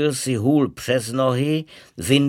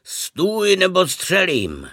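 high, sharp threat from the bushes; a fierce hidden voice shouting a threat; terrible voice; 7 semitones higher than usual. A narrator's reading. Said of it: "ozval se z křoví hrozný hlas".

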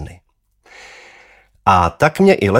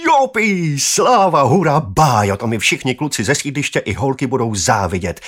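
high, loud shouting for joy; wild cheering; 7 semitones higher, 3 dB louder than usual. A narrator's reading. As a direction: yell like a kid gone crazy with delight. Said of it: "řval jsem jako blázen".

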